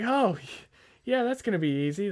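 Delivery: high-pitched mock confidence; sarcastic. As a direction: retell it as a loud, ironic "sure, easy". Retold sarcastic reply to manager.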